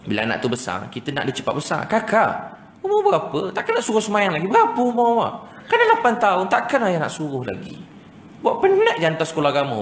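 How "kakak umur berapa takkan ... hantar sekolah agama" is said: high-pitched mock parental scolding; nagging father. Father nagging the eldest child about prayers, mock exasperated scolding. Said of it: "Oh, bapak dia pun kan".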